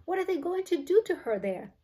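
panicked, high-pitched questioning; alarmed cry. A narrator's reading. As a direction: cry out in fear for the girl. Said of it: "cried Missus Beauregard".

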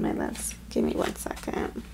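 soft, breathy whisper fighting tears; choked whisper. Real, not acted. Reader whispering to viewers while getting emotional. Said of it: "'Cause I'm starting to get emotional".

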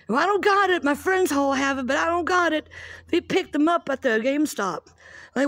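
put-on high, loud sulky teen whine; whiny daughter. Mom imitating daughter complaining that friends have the game, loud high whine. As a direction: whine like a kid left out by her friends. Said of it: "And she's like".

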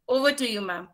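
ordinary delivery

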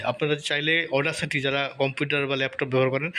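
ordinary talk

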